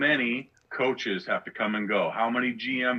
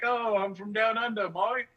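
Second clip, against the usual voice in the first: mocking fake Australian accent, pitched much higher; bad Australian accent; 9 semitones higher than usual. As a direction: ham up a bogus Aussie accent to tease a friend. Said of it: "and now he's like".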